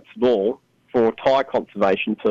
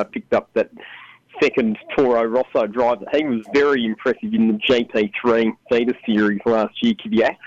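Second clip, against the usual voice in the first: ordinary talk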